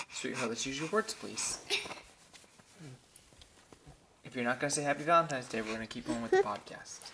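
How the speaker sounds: soft, firm parental bargaining. Real, not acted. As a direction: gently lay down the terms. Bargaining with a toddler.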